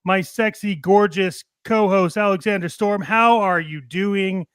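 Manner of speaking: usual speaking voice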